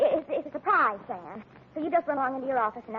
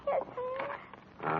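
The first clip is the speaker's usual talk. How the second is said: high, soft whimpering reply; tearful yes; 8 semitones higher, 10 dB quieter than usual. Acted. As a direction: give in with a little cry. Caught secretary meekly gives in.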